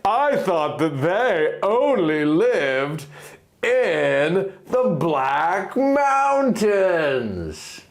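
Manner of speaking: a lightly voiced young man, puzzled and slow; a hint of a baffled hero's voice; Link, puzzled. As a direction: gently act out a puzzled husband. Link's voice in story.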